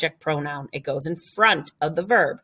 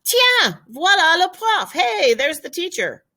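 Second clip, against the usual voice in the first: excited spotting; 12 semitones higher, 3 dB louder than usual. Pretend. Spotting the teacher, calling out.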